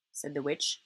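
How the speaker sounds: plain reading voice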